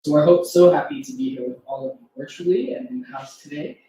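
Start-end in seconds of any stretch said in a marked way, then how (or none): none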